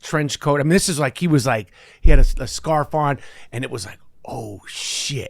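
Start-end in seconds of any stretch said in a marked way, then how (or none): 4.3-5.3 hushed, whispered awe; awed whisper. Retold or imitated. Young actor spotting his idol on set, awed whisper. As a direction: whisper it under the breath. Awed inner reaction, retold.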